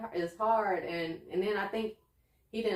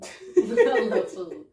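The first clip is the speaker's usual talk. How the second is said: drawn-out, louder words through a laugh; 4 semitones higher, 9 dB louder, much slower than usual. Real, not acted. Confessing a love of food to her friend, laughing. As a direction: crack up while saying it.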